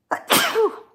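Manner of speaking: sharp, sudden real cough; loud cough mid-stream. Real, not acted. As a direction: cough it out hard.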